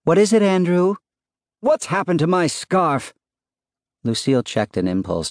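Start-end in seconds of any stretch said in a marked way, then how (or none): 0.0-1.0 high, loud call up the stairs; calling back. A narrator's reading. Wife calls up to husband from bottom of steps, calling back. 1.6-3.1 high-pitched holler from upstairs; shouted question. A narrator's reading. Husband hollering to wife about his lost scarf, shouted. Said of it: "Andrew's voice shouted".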